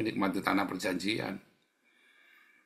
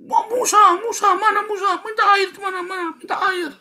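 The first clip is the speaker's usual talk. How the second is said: very high, loud wailing screech; mock screaming; 10 dB louder than usual. Preacher mimics Israelites begging Moses for water, mock hysterical screaming. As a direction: wail hysterically, hammed up. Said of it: "Jangan kamu jejeritan".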